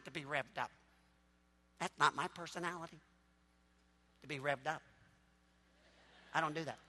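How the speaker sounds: very quiet, low, deadpan refusal; mock subdued objector. Imitating churchgoer who won't get revved up, subdued. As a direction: drop it right down, utterly unrevved.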